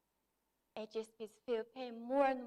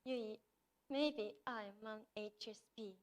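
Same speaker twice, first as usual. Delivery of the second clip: trembling voice; 3 semitones lower, 9 dB quieter, slower than usual. Retold or imitated. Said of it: "she said in a trembling voice".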